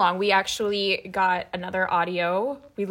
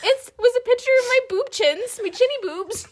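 high laughing voice; giggling through the words; being tickled; 12 semitones higher, 4 dB louder than usual. Real, not acted. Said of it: "that really tickled you".

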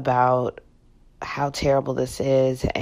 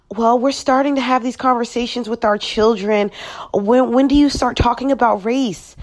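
higher-pitched mock of earnest talkers; mocking quote; 6 semitones higher than usual. Mimicking people discussing race with their children, mocking. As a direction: imitate them in a raised, scornful voice. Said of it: "I'm sick of y'all talking about".

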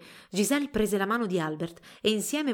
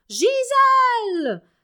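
very high, long-drawn scream of the name; furious mother's shrill call across the crowd; piercing shriek; 10 dB louder, much slower than usual. A narrator's reading. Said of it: "una voce acutissima".

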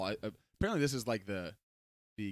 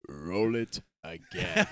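put-on very slow toddler demand; 4 semitones lower, much slower than usual. Dad imitating his son asking to replay, very slow. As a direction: drag it out like a tiny kid. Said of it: "and he goes".

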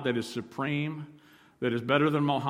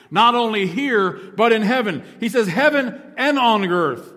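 preaching at full force; 6 semitones higher, 8 dB louder than usual. Acted. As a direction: proclaim the verse to the rafters.

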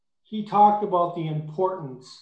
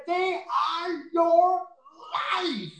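founder's emphatic cry; 10 semitones higher, slower than usual. Retold or imitated. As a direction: imitate the founder calling out "They are your life" slowly. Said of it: "like that".

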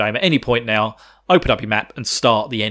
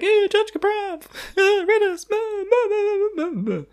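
very high, babbling mimicry; mock character babble. Recapping the boss's speech for listeners, high mocking babble. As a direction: squeak through the speech as mock blather. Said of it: "and being like".

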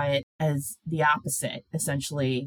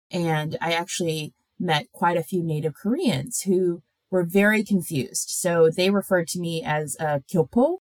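normal talking voice

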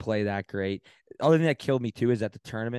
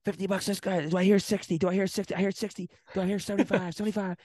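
high, fast auctioneer call; auctioneer yelling; 11 semitones higher than usual. Retold or imitated. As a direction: rattle off bids like an auctioneer. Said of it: "So I'm yelling".

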